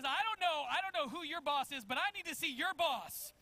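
loud, high, emphatic mock demand; insistent demand. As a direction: press the point like a mother who won't leave. Retold mother demanding the boss.

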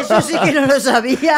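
laughing through the words; amused disbelief, laughing as you speak. Real, not acted. Mother reacting to her son's joke, laughing.

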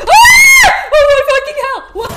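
high panicked scream; terrified scream. Real, not acted. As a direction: shriek at the jump scare. Jump scare in horror game.

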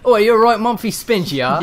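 loud, high mock-British banter; hammed-up Brit with nonsense slang; British accent. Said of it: "my favorite British-ism".

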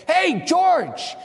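high, loud shout across the shop floor; warning call. Retold or imitated. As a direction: call out sharply to stop him. Worker calling a warning.

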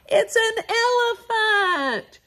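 high, bright excited announcement; excited reveal. Acted. Children's song host, calling out the elephant excitedly. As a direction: announce the animal with glee.

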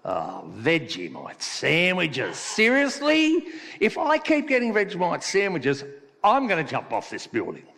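exasperated Aussie in a joke; fed-up Australian worker. Acted. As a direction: whine it in mock outrage, then settle. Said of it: "The Australian opens his lunchbox".

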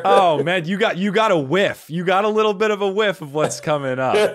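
loud, high gleeful teasing; excited teasing. Real, not acted. Host teasing guest about the crummy quotes, loud and gleeful. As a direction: hype up what's coming next.

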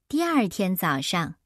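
plain reading voice

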